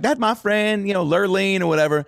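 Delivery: high-pitched mock Houston twang; thick Houston accent. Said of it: "real thick accent".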